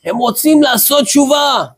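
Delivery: high-pitched retold yell; imitated shouting. Young man tells deaf old rabbi in his ear, imitated shout. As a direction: call it out as if into a hard-of-hearing ear. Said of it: "צועק לו באוזן".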